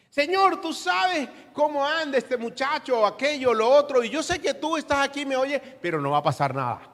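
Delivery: high, whiny imitation of a faithless prayer; mock hopeless prayer. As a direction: mimic someone praying without faith. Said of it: "oramos todos los días diciendo". (imitando una oración sin fe, aguda y quejosa)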